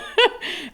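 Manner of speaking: a high, short laugh; amused laugh. Real, not acted. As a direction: laugh at the teasing. Rit de la taquinerie. (petit rire aigu et amusé)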